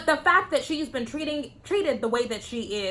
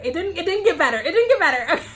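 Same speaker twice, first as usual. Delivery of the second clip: higher, louder, laughing at yourself; 7 semitones higher, 4 dB louder, faster than usual. Real, not acted. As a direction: admit defeat through giggles. Laughing at failed second attempt.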